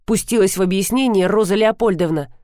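usual speaking voice

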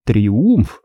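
ordinary delivery

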